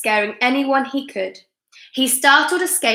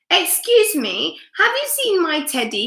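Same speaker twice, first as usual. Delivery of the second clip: timid polite wolf; 6 semitones higher than usual. A narrator's reading.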